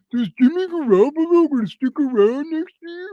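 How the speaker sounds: high, loud mock fan voice; parroting the hopeful fans sarcastically; sarcastic impression of hopeful fans. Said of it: "And people are saying".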